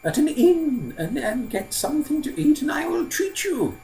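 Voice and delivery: very high, slow, hesitant elder; old man, kindly inviting. Acted. Old man offers to treat Simpleton, high and halting.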